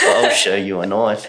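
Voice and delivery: slow, drawn-out mock Australian accent; terrible fake Australian accent. Host answering the film quote, slow fake Australian accent. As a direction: stretch out a badly faked Australian accent. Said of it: "their terrible accent of trying to do the Australian one".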